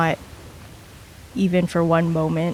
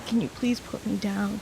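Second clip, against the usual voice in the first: soft, worn-out request; drained plea; 3 semitones higher, 8 dB quieter than usual. Acted. Exhausted character asking the crewmate holding her, softly drained. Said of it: "I have no energy".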